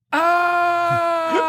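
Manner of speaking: long, high held "ohhh"; sudden-realization "oh". Retold or imitated. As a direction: draw out a high, mock-dawning "oh". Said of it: "The light bulb went off".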